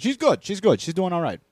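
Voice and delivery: high, loud, hammed-up Italian-American goombah. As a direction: play an excitable Italian wise guy. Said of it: "we're getting Italian on this one".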